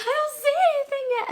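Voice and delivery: very high-pitched mock panic; squealed, panicky retelling; frantic protest. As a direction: act desperate, squeaking it out. Frantic reply to friends, retold.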